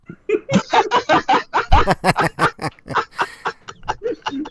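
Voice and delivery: high squealing laugh. Real, not acted. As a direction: burst out laughing. Big laugh at MXT.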